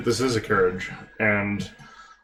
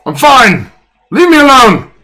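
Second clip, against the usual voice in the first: put-on high, loud drunken yell; shouting drunk; 12 semitones higher, 11 dB louder than usual. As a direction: yell at them to go away.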